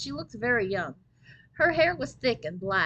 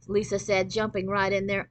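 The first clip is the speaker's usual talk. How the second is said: usual speaking voice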